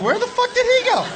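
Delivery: high-pitched mock bewilderment; baffled outcry. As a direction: act out a stunned onlooker. Baffled onlooker, acted in story.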